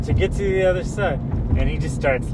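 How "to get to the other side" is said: gleeful punchline. Retold or imitated. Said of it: "And he goes".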